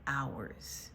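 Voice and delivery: ordinary delivery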